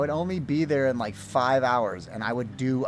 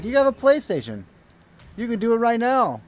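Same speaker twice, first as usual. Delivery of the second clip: higher and louder, eager urging; excited friend; 10 semitones higher, 7 dB louder than usual. Retold or imitated. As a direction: push him like it's obvious. Friend eagerly pushing him to stream.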